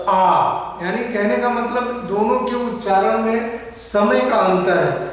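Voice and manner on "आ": 'आ' stretched out slowly, twice as long; long drawn vowel. Shown as a demonstration. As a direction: hold the आ long and slow. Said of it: "आप उच्चारण करके भी देख सकते हैं". ('आ' को धीमे और लंबा खींचकर बोलें)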